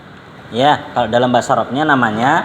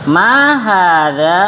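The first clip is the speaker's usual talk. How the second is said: slow, stretched question tune on "Ma hadza"; question intonation; 2 semitones higher, 3 dB louder, much slower than usual. Shown as a demonstration. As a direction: say "Ma hadza" very slowly as a lilting question. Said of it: "cengkoknya juga cengkok pertanyaan".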